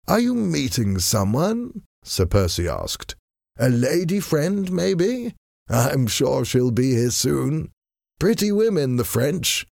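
lazy aristocrat needling his enemy, amused; foppish gentleman. A narrator's reading. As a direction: tease the choking villain with idle charm.

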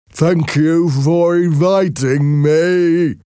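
high, slow, gentle-mannered Merrick voice; timid deformed man, high and slow; Merrick's voice. A narrator's reading.

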